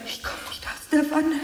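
strained call. Acted. Weak woman calling for help.

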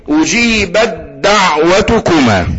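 slow أجيبت دعوتكما, ت merged into a held د; doubled دال. Shown as a demonstration. Teacher leads students after questioning, doubled دال. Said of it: "هننطقها مشددة؟".

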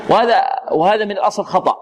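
ordinary talk

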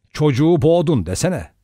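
ordinary delivery, nothing marked

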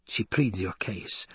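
soft, slow murmur; hushed aside. A narrator's reading. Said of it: "leaned close to his ear and whispered".

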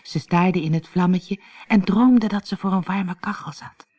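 ordinary delivery, nothing marked

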